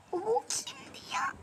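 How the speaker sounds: slow whispered suspicion; hushed whisper. Real, not acted. Jealous whispered question.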